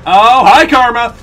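loud, wry hello to fate; sarcastic greeting. Real, not acted. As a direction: greet bad luck with sarcasm. Karma strikes right after jinx.

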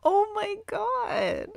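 slow, high overjoyed cry. Real, not acted. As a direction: breathe it out high and slow with joy. Overjoyed at villager.

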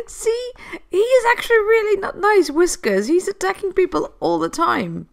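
high exasperated complaint. Real, not acted. Complains about attacking cat Whiskers.

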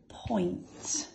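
teacher whispers Point to the class; whispered. Shown as a demonstration. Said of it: "We'll do one more".

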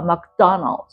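"マクドナルド" as English McDonald's, DON standing out; stressed middle, weak ends. Shown as a demonstration. Teacher showing which parts to weaken, English stress. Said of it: "こっちとこっちは弱めるわけよ".

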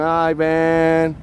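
sustained, drawn-out call; held-out name. Shown as a demonstration. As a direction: stretch the name out long. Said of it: "I'm gonna keep going".

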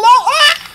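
high startled yelp; a sudden high cry of alarm. Real, not acted. Gamer jolted by a sudden attack, high yelp.

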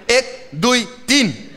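put-on slow, high Hindustani-accented count. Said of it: "In het Hindoestaans".

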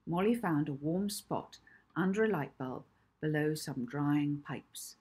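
ordinary delivery, nothing marked

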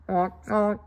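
a lightly voiced goose honk; mild imitation of a goose. Goose sound in story.

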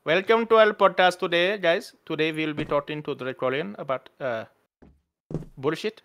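cheerful put-on Indian accent; host a podcast in an Indian accent; mock Indian-accented show host. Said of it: "when you're doing Indian accent".

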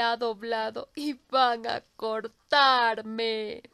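put-on crying old tree. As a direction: weep out your sorrow as the old tree. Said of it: "lloró desconsoladamente".

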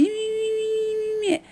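high, mocking chant of me. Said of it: "you interrupt me".

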